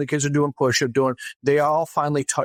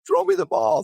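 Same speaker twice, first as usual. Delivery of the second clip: put-on much higher kid calling out; a hint of a distant kid's call; 13 semitones higher than usual. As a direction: kid yelling from far down the field. Kid calling from far away.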